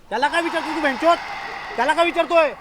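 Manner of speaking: loud, high, aggressive demand; angry interruption. Acted. Angry friend cuts off the one explaining, furious.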